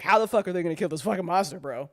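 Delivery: ordinary talk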